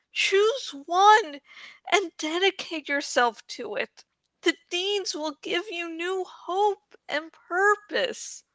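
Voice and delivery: steady him with calm wisdom; wise Saphira. Acted. Dragon gently counselling grieving Eragon, soft wise voice.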